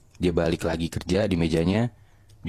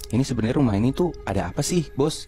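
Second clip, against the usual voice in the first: higher, quicker questioning of the boss; retold confrontation; 7 semitones higher than usual. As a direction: press the boss for an answer, raised pitch. (menuntut penjelasan dari bos)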